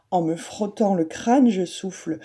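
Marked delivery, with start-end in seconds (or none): none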